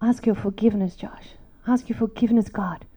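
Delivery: low, soft plea for forgiveness; hushed and contrite, names sinking away; tearful apology, retold. Mom asking son and God's forgiveness, quietly contrite. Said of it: "lap and I cry".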